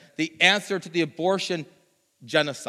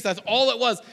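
ordinary delivery

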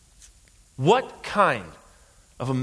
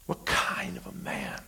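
hushed, breath-only whisper; whispered question; 4 semitones higher, 10 dB quieter than usual. Real, not acted. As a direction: let it sink to a stunned whisper. Hushed repeat after shouted climax.

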